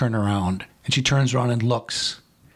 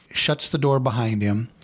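ordinary talk, nothing marked

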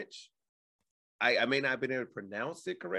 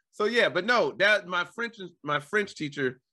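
usual speaking voice

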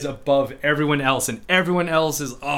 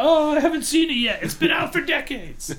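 mock indignant; 4 dB louder than usual. Mock clueless spoiler complaint.

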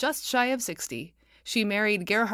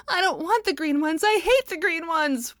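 put-on high, loud little boy's whine; a small boy wailing his refusal; wailed; 10 semitones higher, 5 dB louder than usual. A narrator's reading. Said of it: "wailed Saul".

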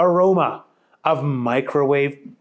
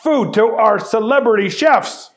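louder, higher firm scolding; stern scolding; 8 semitones higher, 6 dB louder than usual. Acted. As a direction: lay down the rules to Booger. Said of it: "yelled Chet".

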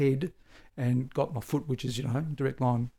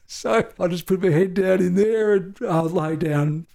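laughing through the words, higher and louder; 6 semitones higher, 4 dB louder than usual. Real, not acted. Officer recalling his cover behind a small tree, laughing. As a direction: chuckle at the absurdity of it.

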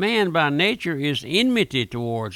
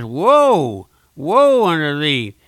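thundering warning; 8 dB louder, much slower than usual. Acted. Preacher quoting God's warning.

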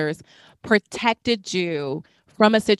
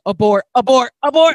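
rising panicked shout of abort; louder each time, yell abort; mock panic, building to a shout; urgent alarm; 3 semitones higher, 9 dB louder than usual. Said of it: "And I was like".

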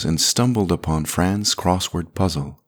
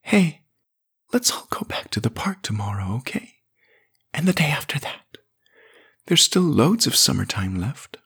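loving whisper; 9 semitones higher than usual. A narrator's reading. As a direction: whisper to sleeping children. Fran whispers to dead children.

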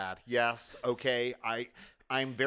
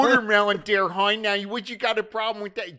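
a light Baltimore accent, louder, Watermelon as "wudder-melon"; 5 semitones higher, 6 dB louder than usual. Shown as a demonstration. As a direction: lean into a Baltimore accent, a touch. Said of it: "Joe hates my Balmer accent".

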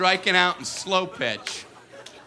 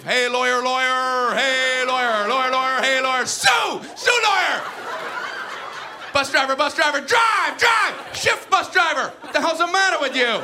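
loud, high heckling chant; mock chatter shouting; 7 semitones higher, 4 dB louder than usual. Kid heckling a lawyer and bus driver, loud mock shout. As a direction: yell it like a kid in the field.

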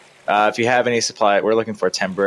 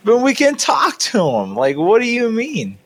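mocking high voice; 13 semitones higher than usual. Player protests in-character argument.

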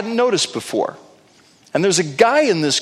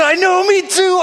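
excited agreement; 4 dB louder than usual. Retold or imitated. His eager agreement with wife.

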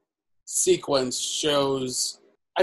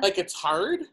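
put-on Valley Girl accent; 3 semitones higher, 5 dB quieter than usual. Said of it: "it's a Valley Girl accent".

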